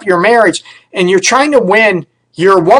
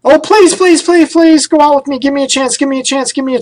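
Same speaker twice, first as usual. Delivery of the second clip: much higher, pleading wheedle; begging suitor; 8 semitones higher than usual. Retold or imitated. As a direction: beg desperately for a date, whining. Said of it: "beg and plead".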